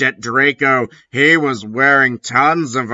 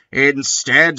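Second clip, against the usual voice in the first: ordinary delivery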